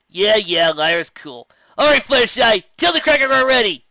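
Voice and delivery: loud, high, brash and cocky; brash Fire. Acted. Fire brushes off the praise and calls to Fluttershy, brash and loud. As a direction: toss it off cocky, then bark the order.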